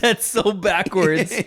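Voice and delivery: slow words through laughter; amused laughing disbelief. Real, not acted.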